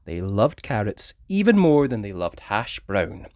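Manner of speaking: usual speaking voice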